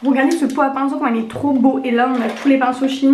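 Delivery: normal talking voice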